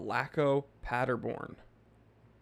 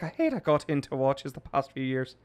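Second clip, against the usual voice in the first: second, broader put-on Irish accent; mock Irish voice, running straight through; Tom Mulrooney. Streamer rereading a chat comment as another Irishman, Irish accent. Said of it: "That was Tom Mulrooney".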